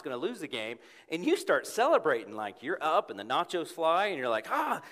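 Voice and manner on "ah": fan cheer. Retold or imitated. Fan celebrating home run.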